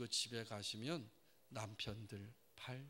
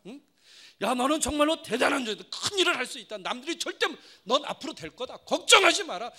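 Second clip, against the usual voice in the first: much louder, higher forced cheer; booming praise; 12 semitones higher, 20 dB louder than usual. Retold or imitated. Father texting his son over-the-top praise, loud. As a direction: pump him up at full volume.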